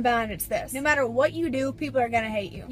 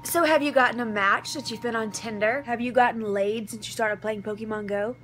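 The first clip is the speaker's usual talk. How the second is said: usual speaking voice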